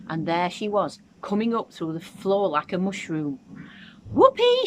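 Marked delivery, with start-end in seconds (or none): 4.1-4.7 very high, loud whoop of glee; shouted. A narrator's reading. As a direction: whoop it out with wild joy. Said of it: "she shouted".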